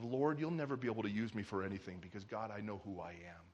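low, hushed, defeated prayer; weighed-down murmur to God, voice dropping away; heavy-hearted. Acted. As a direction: sink into quiet, burdened resignation. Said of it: "just with this heaviness".

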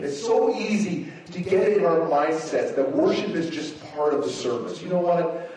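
normal talking voice

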